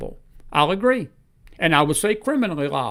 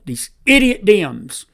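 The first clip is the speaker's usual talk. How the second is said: slow, louder sneer at idiot Dems; angry jab; 3 semitones higher, 5 dB louder, slower than usual. Real, not acted. Host to viewers, angry sneer. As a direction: spit out the insult. Ranting host attacking politicians.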